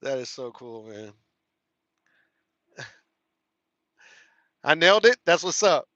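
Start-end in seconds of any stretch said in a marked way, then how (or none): 4.6-5.9 loud, proud cheer; triumphant. Real, not acted. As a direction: celebrate like you just won. Proud after pronouncing the word.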